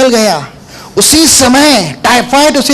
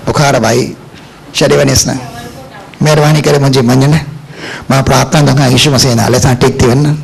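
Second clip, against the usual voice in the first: sick woman's plea; 9 semitones lower, faster than usual. Retold or imitated.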